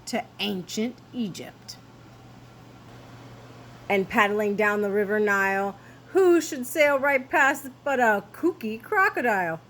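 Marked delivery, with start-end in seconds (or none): none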